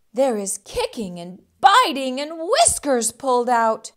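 lively fight-scene storytelling; excited narration. A narrator's reading. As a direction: build up the brawl.

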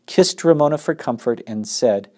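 plain reading voice

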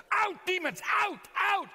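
high, mock exorcism cry; exorcist. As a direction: cast the demons out with a shrieked command. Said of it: "I wanna perform an exorcism".

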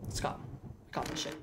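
hushed, low voice; very quietly. Real, not acted. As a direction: keep it down, creeping back cautiously. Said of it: "Very quietly".

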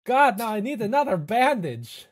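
high, loud yelp of pain; pained librarian. Acted. Librarian cuts himself on a page, high pained yelp. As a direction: cry out sharply at the paper cut.

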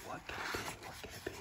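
very soft, voiceless whispering; barely audible, hushed wondering; suspenseful whisper. Pretend. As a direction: whisper it as if something might hear. Hushed suspense before turning the page.

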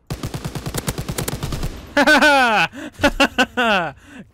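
loud gleeful laugh; triumphant laughter. Real, not acted. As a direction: laugh in victory.

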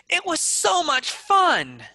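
high, loud burst of excitement; excited. Shown as a demonstration. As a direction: gush with big lifted enthusiasm. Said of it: "Be excited".

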